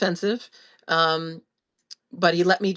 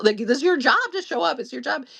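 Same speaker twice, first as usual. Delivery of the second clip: high-pitched, insistent reproach; exasperated retold demand; 7 semitones higher than usual. Daughter telling father to show up, exasperated insistence. Acted confrontation with her father.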